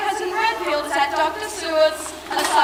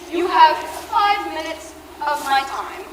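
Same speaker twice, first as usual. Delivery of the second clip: ordinary talk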